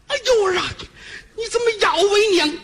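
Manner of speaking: high, sharp cry of pain and shock. Acted. Mother cries out in pain at her son, high shocked yelp. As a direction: yelp as the ear is bitten.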